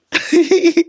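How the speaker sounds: real high-pitched giggle; squeaky burst of amusement. Real, not acted. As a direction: giggle at your own cheek. Laughs at own story.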